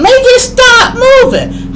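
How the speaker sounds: very high, loud slow wail; hammed-up high-pitched frantic scream; mock panicked yell. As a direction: shriek it like a panicking passenger. Mimics panicking passengers yelling at operator.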